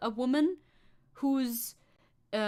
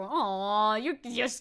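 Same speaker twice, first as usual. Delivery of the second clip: ordinary talk, nothing marked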